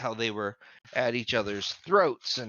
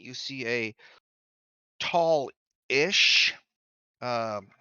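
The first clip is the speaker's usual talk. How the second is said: ordinary talk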